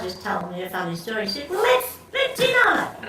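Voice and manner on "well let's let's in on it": much higher, louder wheedle; coaxing son. Retold or imitated. Son John coaxing his mother, high and playful. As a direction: playfully demand to be let in.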